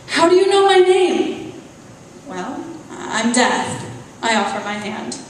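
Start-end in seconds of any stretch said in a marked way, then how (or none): 0.1-1.5 much higher and louder, frightened; frightened woman questions the intruder, high and loud; alarmed question. Acted. 2.3-3.9 slow and unbothered; calm Death. Acted. Death introduces herself calmly.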